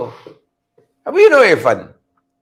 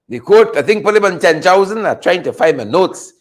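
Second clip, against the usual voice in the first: ordinary talk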